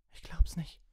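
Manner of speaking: put-on high, loud girl's cry; screamed disbelief. A narrator's reading. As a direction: shriek it in outraged disbelief. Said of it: "schrie Hermine".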